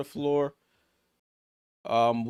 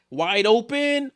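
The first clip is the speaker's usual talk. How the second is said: excited; 8 semitones higher, 4 dB louder, slower than usual. Real, not acted. Open shooter call.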